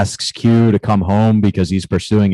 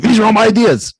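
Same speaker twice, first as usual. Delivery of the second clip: high, mocking ambush line; mocking quote; 13 semitones higher, 4 dB louder, faster than usual. Host mocks guest's ideas in a high squeal. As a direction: squeal it like a gotcha.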